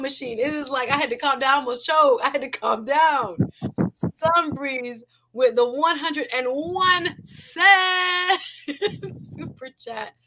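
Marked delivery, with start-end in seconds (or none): 3.9-10.1 slow, drawn-out excited shout of the name. Real, not acted. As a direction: cry out the donation in disbelief. Reacting to big super chat.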